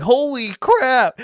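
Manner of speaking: high, slow, stretched-out holy crap; stunned fright. Retold or imitated. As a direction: let the shock stretch the words out. Seeing hallway turn to bones.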